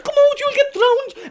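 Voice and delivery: very high, loud shriek; panicked call. Acted. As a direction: scream in alarm. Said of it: "And she called".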